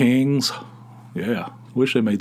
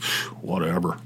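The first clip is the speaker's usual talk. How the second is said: soft, low brush-off; wife's quiet unimpressed sigh; dismissive; 6 semitones lower, 6 dB quieter than usual. Retold or imitated. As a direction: wave it away like a tired wife. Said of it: "Tony's like".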